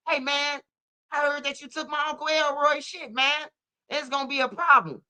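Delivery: high-pitched cartoon menace; mock cartoon character. Cartoon character confronting someone over stolen goods, high mock threat.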